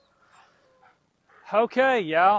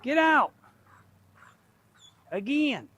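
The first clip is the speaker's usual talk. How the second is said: exasperated shout; 11 semitones higher than usual. Real, not acted. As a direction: raise the voice, fed up with the dog. Dog keeps coming in.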